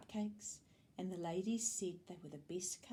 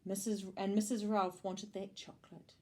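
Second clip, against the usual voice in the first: ordinary delivery, nothing marked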